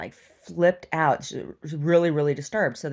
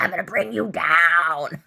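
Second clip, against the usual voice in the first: slow, louder threatening put-on voice; menacing imitation; 4 dB louder, slower than usual. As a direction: threaten slowly like the crazy woman. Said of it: "She's like".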